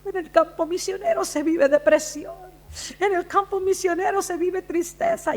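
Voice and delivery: high, whiny mock lament; missionary's woeful wife. Preacher quoting a missionary's wife on depression, mock-mournful. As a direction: mimic her gloomy complaint in a high voice. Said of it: "a la esposa de una misionera".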